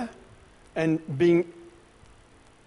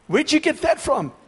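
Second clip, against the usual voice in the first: much higher, startled disbelief; incredulous thought; 10 semitones higher, slower than usual. Retold or imitated. Preacher recalling his own shock at another minister's teaching, much higher and incredulous. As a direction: recoil at a strange teaching. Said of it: "some of the stuff I thought".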